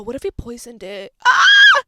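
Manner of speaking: mock-panicked scream; sudden alarmed shout. Real, not acted. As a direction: shriek at the sudden thought of poison. Sudden poison realization, shout.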